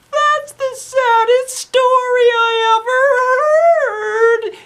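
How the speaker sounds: very high, loud, slow, sung-out wail. Acted. Girl wails over the sad principal story. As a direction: wail it like bursting into tears. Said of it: "Then she started sobbing".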